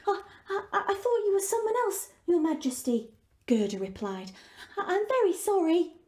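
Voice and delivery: put-on a frightened girl apologising; lightly voiced nervous girl; stammering girl. Gerda apologises to prince.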